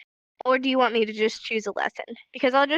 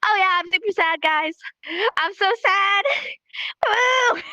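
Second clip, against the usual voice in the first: high, giddy, laughing claim of sadness; hammed-up glee, completely mismatched to the words; mock-cheerful sad; 7 semitones higher than usual. Shown as a demonstration. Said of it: "I'm not gonna be like".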